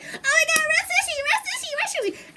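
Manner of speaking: very high, gushing squeal; acting out overjoyed fan excitement; excited fangirl squeal. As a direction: chant the name in a giddy high voice. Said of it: "every time when Raw Sushi videos come out".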